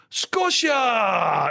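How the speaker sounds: high, drawn-out imitation of a delighted cry; excited exclamation. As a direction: call it out long and high, as retold locals. Locals react with delight on hearing Scottish.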